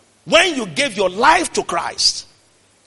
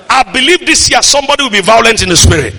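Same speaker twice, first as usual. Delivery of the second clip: loud, sustained declaration over the congregation; prophetic preaching; 3 semitones higher, 8 dB louder than usual. Real, not acted. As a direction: proclaim blessing at full force. Prophetic declaration at full force.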